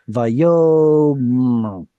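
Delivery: "vayom" with long YO and hummed final m; hypothetical original form. Shown as a demonstration. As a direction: stretch "vayom", closing on m with no vowel after. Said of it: "It should have been".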